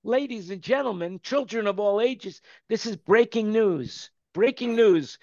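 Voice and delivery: big booming announcer. Pretend. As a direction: hype it like breaking news. Mock news announcement opener.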